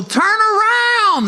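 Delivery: high, slow, loud drawn-out call; dramatic reveal. Acted. Arena announcer telling the wife to turn, slow and high. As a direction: stretch it out high like a big reveal.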